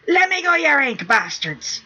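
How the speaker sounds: high, loud, furious pirate yell. A narrator's reading. Sniff yelling at the pirates holding him, high and loud. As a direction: yell it while squirming. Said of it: "Sniff yelled and squirmed".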